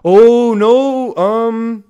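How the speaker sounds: high, loud cry of dismay; high-voiced, startled admission; dismayed realization. Real, not acted. Realises the viewer was right about mistake.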